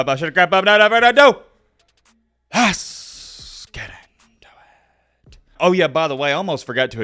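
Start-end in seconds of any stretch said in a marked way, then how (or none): 0.0-1.4 much higher, louder hype-up yell; excited high shout to kick things off. Acted. Hyping himself to start the video. 2.5-5.4 slow, hushed whisper; whispered intro line; dramatic whisper. Acted. Dramatic intro after hype shout.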